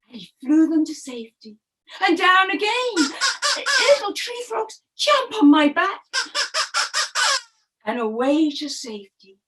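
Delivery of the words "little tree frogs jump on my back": calling out. Acted. Parrot calling to frogs.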